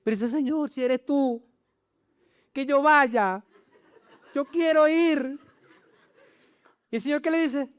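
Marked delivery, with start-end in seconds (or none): 0.3-5.4 high, drawn-out nervous plea; mock-fearful, stretched-out request; scared to death, acted. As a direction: play a terrified disciple volunteering anyway. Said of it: "muerto de miedo".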